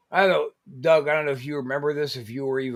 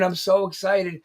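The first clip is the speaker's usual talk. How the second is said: normal talking voice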